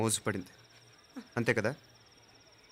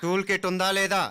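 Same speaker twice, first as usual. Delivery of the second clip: higher, harder repeated question; stern demand; 7 semitones higher than usual. Acted. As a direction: press the suspect for an answer. Same question repeated, pressing the driver. (గట్టిగా నిలదీస్తూ)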